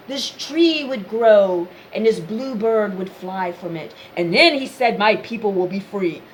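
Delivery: drawn-out parody of a cryptic divine message; mock prophetic riddle voice. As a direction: ham up a solemn oracle talking in riddles. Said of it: "I don't like these long out riddles".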